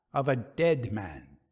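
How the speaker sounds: ordinary delivery, nothing marked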